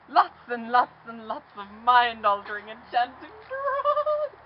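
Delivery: high, slow, spaced-out drawl; dreamy stoned voice. Pretend. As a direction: stretch it out like you're floating. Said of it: "I was like".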